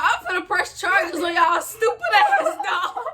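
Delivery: quoting dad while cracking up; half-laughing mock rant; laughing imitation. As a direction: laugh through the angry quote. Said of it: "he talk about".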